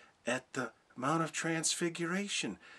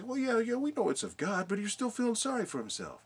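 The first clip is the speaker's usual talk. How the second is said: mocking critics; 9 semitones higher than usual. Preacher mimicking people who call Elijah self-pitying, high and mocking. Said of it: "Now, people say".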